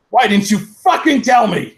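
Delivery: high, loud furious demand; angry shout. A narrator's reading. Draco bursts out at Ebony, angry shout. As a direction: yell it in betrayal. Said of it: "he shouted".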